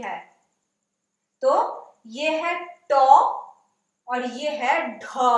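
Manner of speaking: slow, deliberate contrast of ट and ढ; teacher carefully contrasting two letters; slow explanation. Shown as a demonstration. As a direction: spell out the difference slowly for beginners. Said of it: "इन दोनों में फर्क है".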